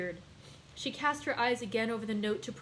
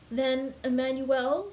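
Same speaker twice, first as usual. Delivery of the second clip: hesitant; 3 semitones higher, 5 dB louder, slower than usual. A narrator's reading. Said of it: "said the young girl with hesitation".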